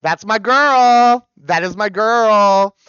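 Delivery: loud, slow proud cheer. Real, not acted. Streamer cheering her favourite singer, loud and slow.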